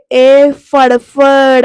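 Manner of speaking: hammed-up mock villain jibe; signature catchphrase. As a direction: draw the catchphrase out like a trademark taunt. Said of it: "आपला patent शब्द वापरते".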